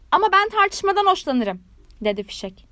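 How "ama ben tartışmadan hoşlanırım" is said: haughty contradiction. A narrator's reading. Rocket contradicts frog.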